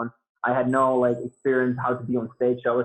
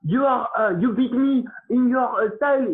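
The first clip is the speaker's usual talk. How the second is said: put-on French accent; 11 semitones higher than usual. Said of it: "in his French accent".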